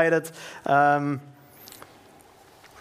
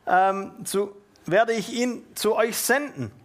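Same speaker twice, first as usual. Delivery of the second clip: higher-pitched, declaimed verse; scripture reading; 4 semitones higher than usual. Acted. Preacher reading Jesus' farewell to the disciples, declaimed. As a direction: read it out clearly from the Bible.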